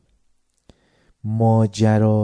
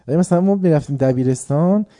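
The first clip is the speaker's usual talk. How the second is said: normal talking voice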